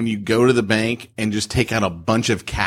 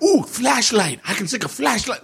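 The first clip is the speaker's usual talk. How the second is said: retold, much higher, gushing over a flashlight; gadget delight; 9 semitones higher than usual. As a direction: play the kid who found a shiny toy. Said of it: "I'm like".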